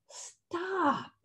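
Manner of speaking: high, soft, drawn-out call; hushed stop. A narrator's reading. Old Turtle halts the arguing animals, soft high call. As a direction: halt them softly, pitched well up.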